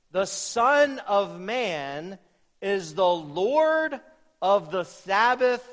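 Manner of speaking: slow, weighty scripture reading; emphatic scripture reading. Acted. Preacher reads Christ's words to the congregation, slow and emphatic. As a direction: read it slowly, pausing between phrases.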